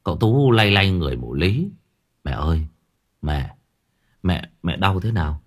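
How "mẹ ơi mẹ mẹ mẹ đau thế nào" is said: worried son. Acted. Son leaning over his sick mother, low and worried. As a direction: call her gently, trying to wake her.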